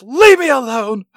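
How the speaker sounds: melodramatic rejection. Acted. [name] rejecting [name] outside her house, in a hammed-up snap. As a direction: fling the line at him.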